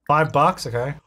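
ordinary talk

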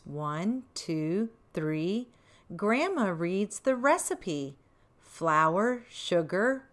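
soft, measured rhyme; reading to children. A narrator's reading. Grandma reading the rhyme to children, soft and measured. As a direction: read the verse gently to little ones. Reads rhyming verse.

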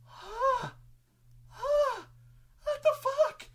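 very high, loud squeak; hammed-up squealing bewilderment; baffled prince. Prince looking down at the carnage, squeaky disbelief. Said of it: "the prince is, like, looking down at this".

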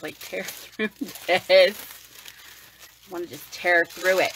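slow, drawn-out eager growl; eager, laughing. Real, not acted. Eager to unwrap the box, slow giddy drawl. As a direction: savour the urge to rip it open.

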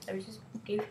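soft whispered aside. Real, not acted. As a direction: breathe it out as a whisper.

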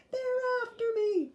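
put-on high panicked little-girl voice; scared child cry. Sister calls to Brother, high panicked cry. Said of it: "she screamed".

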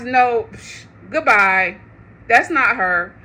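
ordinary delivery

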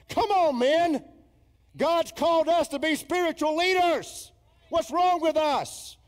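loud, high cry of exhortation to the men; rally them with a preacher's shout; exasperated pulpit plea at full volume; impassioned preaching. Real, not acted.

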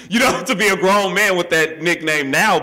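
embarrassed laugh through the words. Real, not acted. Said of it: "it's pretty embarrassing".

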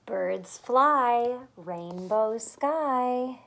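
ordinary delivery

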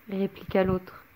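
ordinary delivery, nothing marked

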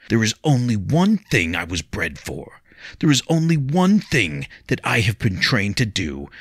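growing fury, threat hammered home; mild mock roar. William turns on Leah, building to a menacing roar. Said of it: "He roared the last".

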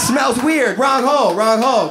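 high, loud mock yell of alarm; sperm yelling. Sperm with munchies to each other, high mock yell. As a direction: shout the warning, hammed up.

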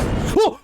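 아주 높은 짧은 비명; 깜짝 놀라 터지는 소리; 놀란 외마디. Real, not acted. (화들짝 놀란 외마디 비명)

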